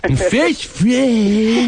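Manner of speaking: high, hugely stretched slurred parody; mock lazy drawl. Host echoes co-host's first friend boast, mock drawl. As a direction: echo it as a long smeared drawl. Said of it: "It's like a lazy way to talk".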